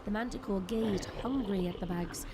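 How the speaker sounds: ordinary talk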